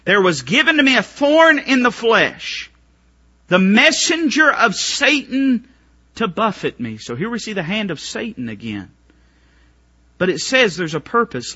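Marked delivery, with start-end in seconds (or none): 0.0-7.0 raised, slow preacher's cadence; fiery preacher reading scripture; preaching. Acted. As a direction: proclaim the verse to the congregation. Preaching scripture at full force.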